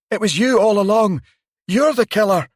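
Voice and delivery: panicked, high-pitched shouting; terrified shout. A narrator's reading. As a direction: scream it in terror at the door. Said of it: "he shouted".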